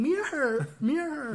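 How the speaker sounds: high, repeated comic challenge; playful mimicked question. Re-enacting the video for a friend, high and playful. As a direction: pitch it up, teasing.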